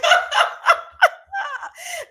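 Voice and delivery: high-pitched burst of laughter. Real, not acted. Host just kidding with viewers, laughing. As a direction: crack up at your own joke. Laughs at her own joke.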